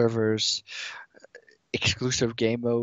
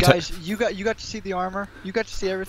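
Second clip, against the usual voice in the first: excited; 10 semitones higher, 4 dB louder, faster than usual. Real, not acted. Impatient streamer urging.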